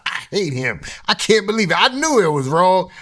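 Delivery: voicing a wounded ex, hammed-up and high; upset women. Women reacting to the apology.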